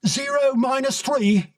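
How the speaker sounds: high posh Kensington officer calling out; shrill Kensington call. A narrator's reading. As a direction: bark the countdown in a high Kensington accent. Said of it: "he called in a shrill Kensington accent".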